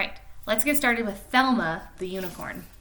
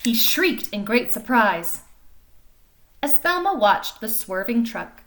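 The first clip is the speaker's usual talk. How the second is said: lively storybook reading voice; animated picture-book narration; reading to children; 4 semitones higher, 11 dB louder, slower than usual. A narrator's reading. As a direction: read it like story time for little kids. Reads picture book aloud.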